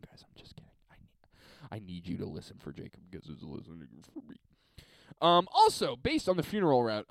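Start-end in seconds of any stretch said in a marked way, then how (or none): 0.1-4.4 soft hushed aside, unvoiced; faint whisper, fast at the end. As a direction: whisper it like a secret. Whispered aside about Jacob.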